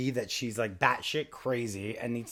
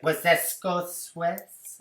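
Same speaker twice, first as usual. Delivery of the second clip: slow drawn-out prim question; haughty clerk drawing it out; mock clerk, slow; 6 semitones higher, 7 dB louder, much slower than usual. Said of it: "He's like".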